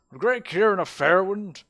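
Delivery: loud, high put-on orator; mayor's voice regained. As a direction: proclaim the name in a grand mayoral voice. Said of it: "I forgot his voice".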